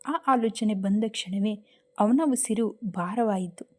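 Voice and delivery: ordinary delivery, nothing marked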